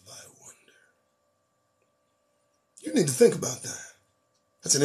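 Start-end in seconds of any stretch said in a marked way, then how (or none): 0.1-0.7 a bare whisper, almost gone; ominous whisper. Real, not acted. Commentator asking listeners a dark question, whispered. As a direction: drop it to a breath of a whisper.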